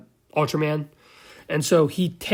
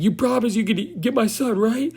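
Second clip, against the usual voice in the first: high-pitched, rising mock plea; hammed-up pleading; mock tearful plea; 6 semitones higher than usual. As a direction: imitate a desperate father, voice climbing to the question. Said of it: "like, crying".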